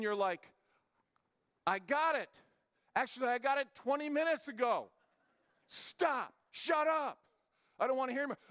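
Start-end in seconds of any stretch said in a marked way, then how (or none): 1.7-8.4 raised, high-pitched mock exasperation; exasperated outburst. Listener worn down by a nonstop talker, acted exasperation. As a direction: cut off the talker, fed up and high.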